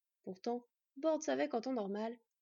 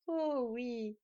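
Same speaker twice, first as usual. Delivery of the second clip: slow, louder, gloating; the thief relishing the price, slow; malicious exclamation; 4 dB louder, much slower than usual. Acted. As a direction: savour his spite slowly. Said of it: "s'exclama le voleur avec une lueur malveillante".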